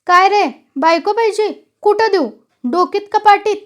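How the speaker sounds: high, loud, angry mockery; bully the petitioner with sarcastic questions; official temper rising. A narrator's reading. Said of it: "सरकारी पारा एकदमच चढला".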